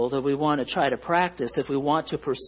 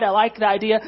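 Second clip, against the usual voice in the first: normal talking voice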